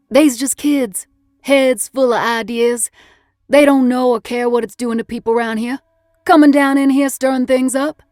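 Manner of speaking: loud, high-pitched cook riled about outsiders; grumbling Southern cook. Acted. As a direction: complain loudly in a folksy Southern voice.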